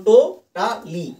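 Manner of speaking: টো-টা-লি slowly, in three detached beats; split in syllables. Shown as a demonstration. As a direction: count out টো, টা, লি one syllable at a time. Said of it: "তিনটে মাত্র syllable হচ্ছে". (টো-টা-লি তিন ভাগে ভেঙে ধীরে বলা)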